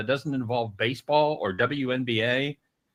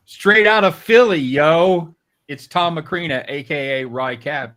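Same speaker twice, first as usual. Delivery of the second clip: hype announcer intro; 4 semitones higher, 9 dB louder than usual. Acted.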